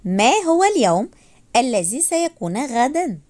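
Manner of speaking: ordinary talk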